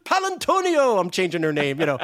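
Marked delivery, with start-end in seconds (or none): 0.0-1.0 high, slow emcee build-up; hammed-up showman announcing a star; mock grand introduction. Billy introducing Marie to the audience, high and grand. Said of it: "He goes".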